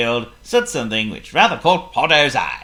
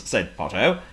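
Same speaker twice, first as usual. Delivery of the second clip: ordinary delivery, nothing marked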